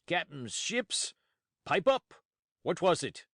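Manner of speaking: bully the answer out of him; berating. Acted. Silver mocking and berating Morgan for his answer. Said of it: "Silver berating Morgan".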